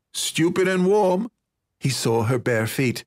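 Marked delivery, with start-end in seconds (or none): 0.1-1.3 higher-pitched nasal comeback; boy's retort. A narrator's reading. Muffled-up boy answering teasing, higher-pitched retort.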